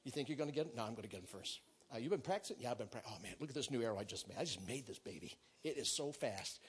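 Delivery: low, hushed back-and-forth; campfire soldier chat. Acted. Soldiers comparing arrows around the campfire, low and quiet. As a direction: murmur it like men boasting privately.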